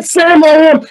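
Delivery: high, full-voiced mock children's yell; kids shouting. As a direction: shout along like a wound-up kindergarten class. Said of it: "the kids are shouting".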